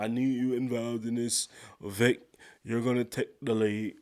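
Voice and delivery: lightly voiced impression of a boss; Papa Flynn impression. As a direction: try a measured impression, not quite nailing it. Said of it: "How y'all like my Papa Flynn impression?".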